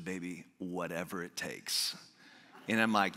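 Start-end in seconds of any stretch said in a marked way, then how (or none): 0.0-1.9 soft, low, tearful wife's whisper-plea; a hint of the wife begging gently; tender plea. Wife to husband in traffic, soft tender plea.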